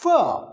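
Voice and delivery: F as "fuh", not the letter name; isolated "fuh" for F; unvoiced consonant demo. Shown as a demonstration. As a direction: sound out F with a short falling vowel. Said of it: "F is unvoiced".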